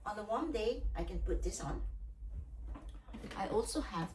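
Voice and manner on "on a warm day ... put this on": ordinary talk, nothing marked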